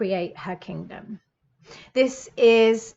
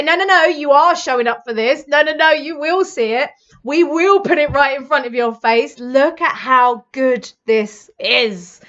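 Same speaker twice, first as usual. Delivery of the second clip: louder, pushy "no, no, no"; insistent; 3 semitones higher, 8 dB louder than usual. Pretend. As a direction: push them firmly toward the good. Life voiced insisting on reader.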